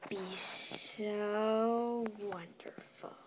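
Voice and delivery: slow breathy whisper of delight. Acted. Shopkeeper dreaming of tomorrow, slow whisper. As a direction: hush it out slowly like a secret.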